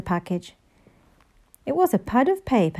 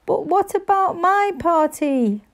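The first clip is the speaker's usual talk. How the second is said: put-on higher wailing child; 9 semitones higher than usual. A narrator's reading. Henry wailing to his parents about his party. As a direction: whine it like a crushed boy. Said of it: "wailed Henry".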